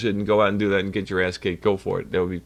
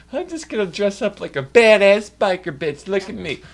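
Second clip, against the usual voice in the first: mocking poser; 7 semitones higher, 5 dB louder than usual. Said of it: "if you wanna be like".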